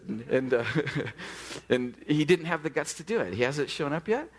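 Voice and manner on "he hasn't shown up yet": usual speaking voice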